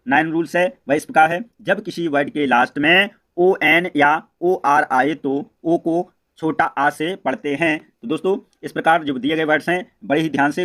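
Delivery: usual speaking voice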